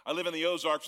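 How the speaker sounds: usual speaking voice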